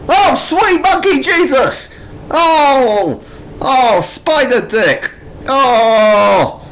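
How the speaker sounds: real shocked outburst at a crude panel; horrified shock. Real, not acted. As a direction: recoil from the page in disgust.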